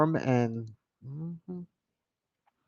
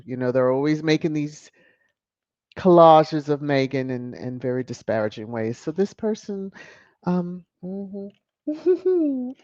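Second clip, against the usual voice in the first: ordinary talk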